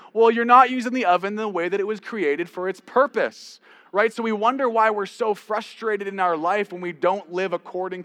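loud, high preaching voice; full-force preaching. Acted. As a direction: preach it out to the whole room.

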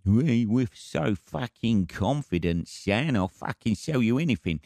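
slow, hammed-up cockney swagger; playful cockney impression; mock cockney boast. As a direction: lay on a London geezer accent. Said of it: "cockneys, man".

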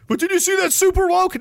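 high-pitched mocking question; mock pesterer. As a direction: squeal it like an overeager nag. Mimics someone pestering him.

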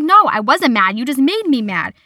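exasperated protest, half in jest; retold annoyance. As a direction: snap back in a retold squabble. Retold quarrel with family.

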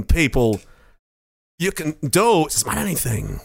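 a creepy movie star musing; Walken impression. Walken voice continued.

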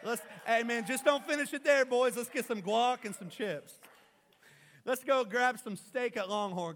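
high, loud, exuberant greeting; excited Jesus. Acted. Preacher plays Jesus greeting his disciples back from ministry, excited shout. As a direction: shout it like hyped-up high-fiving with the boys. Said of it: "he was so excited".

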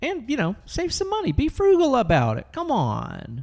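very high, sing-song excuse; mock innocent. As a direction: innocent falsetto justification. Mock justification of sneaking booze.

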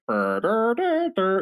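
burred noise jumping up high; imitated audio glitch. Host showing what bad audio sounds like, imitated burring. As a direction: imitate phone-line garble. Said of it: "it sounds like".

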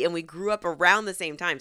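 ordinary talk, nothing marked

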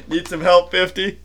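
mock-helpful tease; teasing. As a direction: rib him with a grin. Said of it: "walked over and said".

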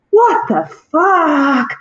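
acted-out stunned reaction; incredulous disbelief. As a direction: stretch every word in mock disbelief. Reaction to unwrapping the wound.

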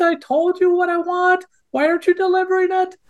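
pinched-nose whine of a sulky partner; whiny voice. Retold or imitated. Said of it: "And you're gonna have this little whiny voice".

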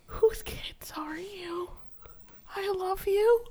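slow, high whisper; gushing whisper. Retold or imitated. Adoring the helpful kids in a whisper. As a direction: whisper it like you're melting over them. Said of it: "I'm like".

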